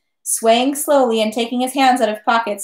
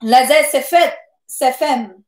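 mildly piercing, raised cry in French; 2 semitones higher, 3 dB louder, slower than usual. A narrator's reading. Young woman cries out as she is robbed, mildly piercing cry. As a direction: cry out with a touch of piercing urgency. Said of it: "cried out in a piercing voice".

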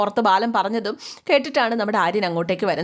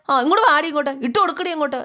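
scolding shout; 6 semitones higher, faster than usual. Acted. Mother orders Vidya to put necklace on crying daughter, shouting. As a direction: yell the order, then hurry it out fast. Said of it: "ആട്ടും വർത്താനമൊക്കെ".

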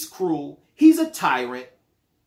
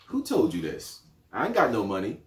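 suspicious denial; 10 semitones lower, 4 dB quieter than usual. Acted. Acted phone call, receiver.

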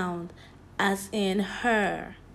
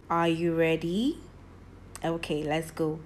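ordinary delivery

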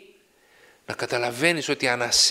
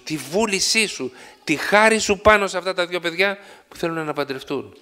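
raised, fervent prayer voice; 5 semitones higher, 3 dB louder than usual. Acted. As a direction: pray aloud over the couple. Prayer for the wedding couple.

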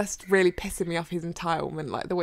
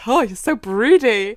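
loud, drawn-out swoon; fangirl gushing; 4 semitones higher, 9 dB louder than usual. Retold or imitated. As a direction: coo loudly over a fictional crush. Acts out gushing reader reaction.

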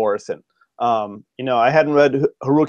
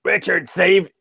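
light old codger impression, pitched up; 5 semitones higher, slower than usual. Imitating the professor giving writing rules, light codger. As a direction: start the professor's advice in his voice. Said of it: "like, an old codger".

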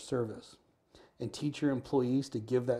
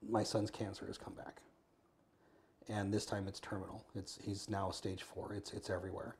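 quiet tearful retelling; 3 semitones lower, 10 dB quieter than usual. Retold or imitated. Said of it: "held her while she cried".

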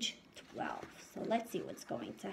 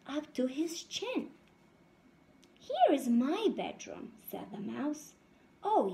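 lively storybook read-aloud voice; reading to children; 3 semitones higher than usual. A narrator's reading. Teacher reading a picture book to her class, lively. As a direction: read it out brightly to the little ones.

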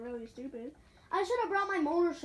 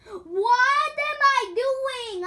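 slow, high, loud wail; mock despair; 10 semitones higher, 11 dB louder, much slower than usual. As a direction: drag it out like a fake breakdown. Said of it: "pretending like I am".

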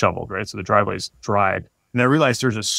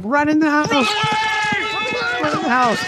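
very high, drawn-out yell; much slower than usual. Retold or imitated. Dad fleeing a moose yells to his kids, very high and stretched. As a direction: yell at the kids to get inside. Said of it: "I start yelling at my kids".

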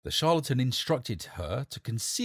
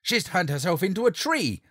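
loud, higher alarmed cry; screamed; 9 semitones higher, 7 dB louder than usual. Acted. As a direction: scream the discovery to the court. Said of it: "screamed the charlatan".

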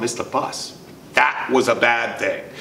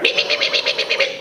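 unvoiced scolding me me me; mock angry mom; faster than usual. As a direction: imitate mom's mad nagging. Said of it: "She went".

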